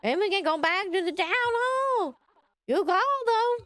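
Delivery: high-pitched character voice. Acted. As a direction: lift it high and draw out the last words. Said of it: "I found the right voice".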